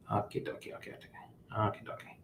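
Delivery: under-the-breath string of okays; murmured to self; whispered okays. Real, not acted. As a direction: whisper it to yourself while working. Mutters while adjusting.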